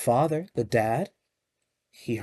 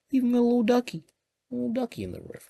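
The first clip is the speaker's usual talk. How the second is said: cutesy high voice; 10 semitones higher, much faster than usual. Acted. Pointing out duck to kids.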